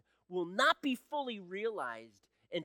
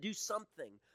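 normal talking voice

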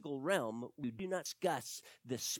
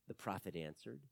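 normal talking voice

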